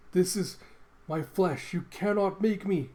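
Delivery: loud and high, desperate refusal; panicked outcry. Acted. Man fighting possession, frantic loud protest. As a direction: scream it as if something is taking over.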